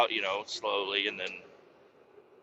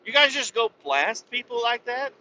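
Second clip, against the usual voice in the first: higher put-on voice, outraged; indignant rant; 7 semitones higher than usual. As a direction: scold the soldiers who shot you. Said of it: "And I'm like".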